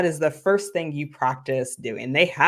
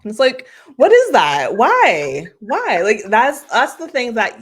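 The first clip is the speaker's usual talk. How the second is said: exasperated; 8 semitones higher, 9 dB louder than usual. Real, not acted. Coach exasperated at flat telling.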